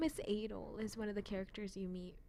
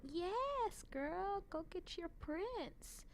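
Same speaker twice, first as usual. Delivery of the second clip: high, gushing cheer; cheering fan; 9 semitones higher, much slower than usual. Retold or imitated. Vlogger cheering on the anime heroine, high gushing squeal. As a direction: squeal it up high like a proud hype friend. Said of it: "And so I'm like".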